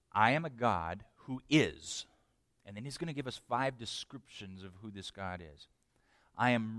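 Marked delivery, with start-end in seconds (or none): none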